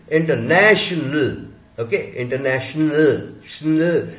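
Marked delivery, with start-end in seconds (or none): 3.5-4.1 shnl said as one cluster, no vowel between sh, n and l; blended shnl. Shown as a demonstration. Teacher to class, blending the ending. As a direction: glide shnl together unbroken. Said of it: "There is no a between sh and na".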